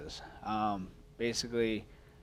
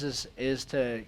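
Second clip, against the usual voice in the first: usual speaking voice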